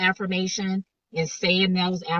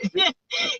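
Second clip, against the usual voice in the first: high laugh; 3 dB louder than usual. Real, not acted. Laugh after the quoted joke.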